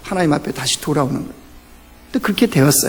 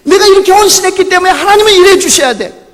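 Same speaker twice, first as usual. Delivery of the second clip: much higher, demanding mock believer's voice; self-righteous believer; 12 semitones higher, 4 dB louder than usual. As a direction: mimic a believer demanding things from God. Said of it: "내가 신앙생활하면서".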